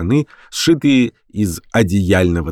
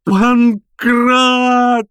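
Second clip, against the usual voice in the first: high, drawn-out yell; frantic shout; 4 dB louder than usual. A narrator's reading. Professor shouting into telephone for Pankrat, frantic. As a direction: bellow the name high into the receiver. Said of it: "закричал в трубку профессор".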